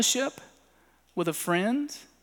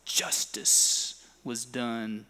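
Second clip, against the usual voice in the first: solemn movie line; 8 semitones lower, 5 dB quieter, much slower than usual. Pretend.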